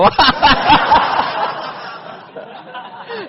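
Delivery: long high silent laugh; high breathless laugh. Real, not acted. Preacher cracking up at the story, a long high breathless laugh.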